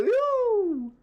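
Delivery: high, held woo; cheer. Real, not acted. Streamer cheering for a viewer from Canada, whooping.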